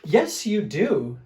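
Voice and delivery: slow, loud insistence; insisting. Acted. Fluffy Bear insists to Smelly Bear, slow and loud. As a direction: put your foot down. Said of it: "said Big Fluffy Bear".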